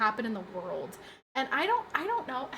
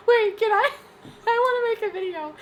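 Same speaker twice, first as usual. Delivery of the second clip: much higher and louder, giggly excitement; excited idea; 10 semitones higher, 5 dB louder than usual. Real, not acted. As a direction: burst out with a fun idea.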